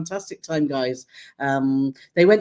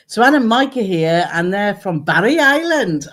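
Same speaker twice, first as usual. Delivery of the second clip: put-on Welsh accent; 3 semitones higher, 5 dB louder than usual. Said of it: "That's my Welsh accent".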